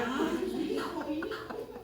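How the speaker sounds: mock the flustered mom begging her son; preacher imitating the helpless mother; fretting mother. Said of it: "y la señora".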